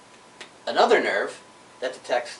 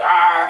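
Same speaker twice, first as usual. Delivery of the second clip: straining grunt; 2 semitones higher, 10 dB louder than usual. Retold or imitated. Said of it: "and he's like".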